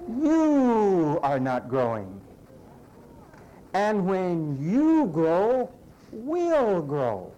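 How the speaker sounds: slow, hammered words; emphatic preaching slowdown. Real, not acted. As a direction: drive each word home slowly. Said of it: "emphatic correction".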